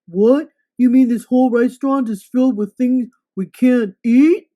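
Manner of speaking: loud outraged monster voice; shocked monster. Acted. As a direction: roar it in disbelief.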